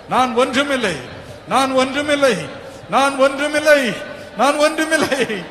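high, loud cry of surrender; humble yourself before God aloud; pleading prayer. Acted. Crying out in prayer. (தாழ்மையுடன் கதறும் ஜெபம்)

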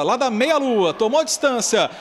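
normal talking voice

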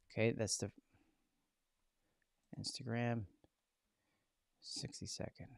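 whispered to himself; whispered aside. Real, not acted. Host checking the screen to himself, whispered. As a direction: half-whisper while reading the screen. Murmuring to himself while typing.

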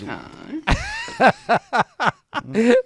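loud burst of laughter. Real, not acted. Big laugh in story.

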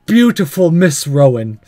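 normal talking voice

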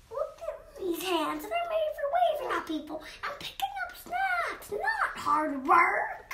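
high, fast, posh playful voice; pampered princess voice. Pretend. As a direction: play the spoiled princess. Child plays a pampered role.

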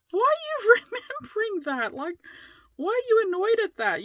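high laughing exasperated protest; own retort, laughing. Retold or imitated. Her own reply to husband, high and laughing. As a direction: squeal it back, amused. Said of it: "And I'm like".

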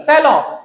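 सैलून as "SAL-aw"; silent final N. Shown as a demonstration. Teacher after "there is no N", सैलून as "SAL-aw". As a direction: drop the n of सैलून, end on "aw". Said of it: "ना नहीं है".

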